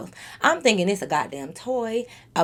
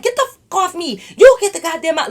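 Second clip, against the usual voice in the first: higher-pitched, mad push-back; retold anger; 6 semitones higher, 5 dB louder, faster than usual. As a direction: act out losing patience with the shover. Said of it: "I'm getting mad".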